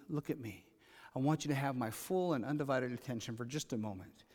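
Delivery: put-on low, hushed parent to a child; quietly firm imitation of a parent; imitated parent. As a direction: drop low and soft, asking a child to focus. Voicing a parent addressing a young kid.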